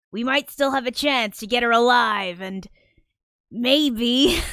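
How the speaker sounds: mock police officer. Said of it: "it's like a missing persons case".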